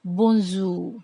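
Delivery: "Bonjour" as bon-zhoo, no r sounded; French bonjour, silent r. Shown as a demonstration. Teacher showing students "Bonjour" without the r. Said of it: "We don't pronounce R".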